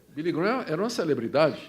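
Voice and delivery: usual speaking voice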